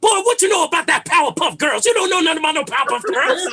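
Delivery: high, loud, badgering challenge; obnoxious old head. Comedian as future old head defending his cartoons, loudly obnoxious. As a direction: mimic your dad's old-head hollering. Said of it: "I'm gonna be an old head too".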